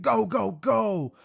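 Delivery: loud, high starting call; hyped-up character shout; announcer starting shout. Acted. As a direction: yell it to launch the race. Present Mike starts the test.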